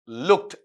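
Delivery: लुक्ड ending in a "t" sound; correct voiceless ending. Shown as a demonstration. Teacher confirming for students, लुक्ड with final "t". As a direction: say लुक्ड as "lukt". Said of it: "लुक्ड ऐसा चलेगा".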